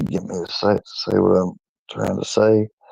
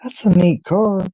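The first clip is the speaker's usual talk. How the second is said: much higher, imitating a cute little girl; high mock voice of a small girl; little girl's voice; 11 semitones higher than usual. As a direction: play the cute girl pointing at the shirt. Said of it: "She said".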